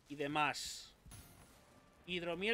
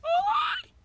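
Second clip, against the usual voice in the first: very high, loud scream; real shriek, voice leaping right up; piercing yelp; 8 dB louder than usual. Real, not acted. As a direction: shriek in sudden panic. Hit during in-game fight.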